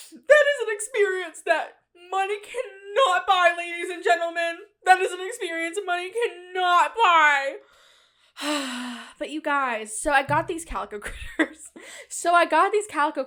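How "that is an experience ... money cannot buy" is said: mock grand announcer.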